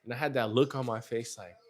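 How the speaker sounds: ordinary talk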